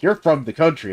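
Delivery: playfully exaggerated blurted observation; mock pointed remark. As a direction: imitate a kid calling you out. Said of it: "look at me and be like".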